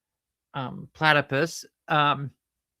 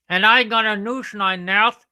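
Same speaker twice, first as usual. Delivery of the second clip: higher, louder attempted Australian; attempted Australian accent; 7 semitones higher, 8 dB louder than usual. Shown as a demonstration. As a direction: push on in a makeshift Australian accent. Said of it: "try to do an Australian accent".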